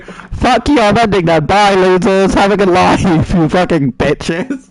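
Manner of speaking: loud joking rant at chat; mock yell. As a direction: shout it like fake rage.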